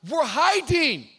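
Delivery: loud, high yelled answer; loud reply. Retold or imitated. Adam yelling back to God, loud. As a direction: blurt it out loudly. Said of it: "God goes".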